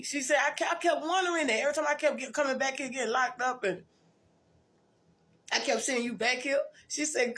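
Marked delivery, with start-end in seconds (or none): 0.4-6.6 a lightly voiced friend, pitched up; half-acting the friend's surprised words; voicing her friend. Friend explaining she kept seeing her in jail, lightly voiced higher.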